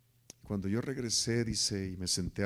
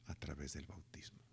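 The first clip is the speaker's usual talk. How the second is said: whisper; 4 semitones lower, 15 dB quieter than usual. Real, not acted. Pastor presses Gabo softly.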